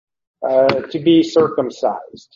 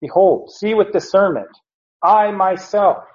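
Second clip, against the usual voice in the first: raised, emphatic "I myself"; emphatic behold; 5 semitones higher than usual. Shown as a demonstration. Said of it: "it's the word for behold".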